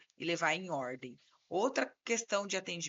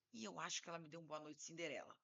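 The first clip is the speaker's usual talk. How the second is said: imitating client, low and much softer; client's comic intonation; 4 semitones lower, 15 dB quieter, faster than usual. Client confessing to his lawyer, comically low-key imitation. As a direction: mimic a meek, understated confession. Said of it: "ele fala nessa entonação, muito engraçado".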